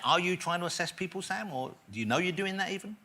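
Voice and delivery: usual speaking voice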